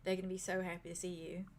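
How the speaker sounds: soft, quiet British innkeeper voice; British accent. Acted. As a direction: speak gently in a British lilt. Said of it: "slip into a British accent".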